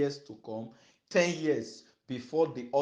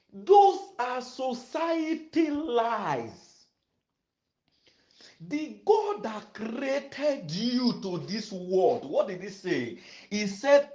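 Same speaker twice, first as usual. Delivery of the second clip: loud, drawn-out preacher's proclamation; full-force preaching; 5 semitones higher, 8 dB louder, slower than usual. Real, not acted. Pastor assuring beloved sisters of God's plan, full-force preaching. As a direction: proclaim the scripture loudly, stretching the key words.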